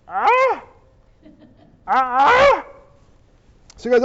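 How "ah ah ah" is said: questioning, rising "ah?"; baffled noises. Acted. Mary fails to follow Gabriel, making confused noises. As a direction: repeat a wordless, dumbfounded query.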